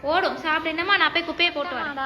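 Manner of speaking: ordinary delivery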